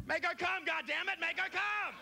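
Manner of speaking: high-pitched furious yelling; pissed-off neighbor. Acted. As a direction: yell your angry demand at the noisy couple. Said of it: "pissed off".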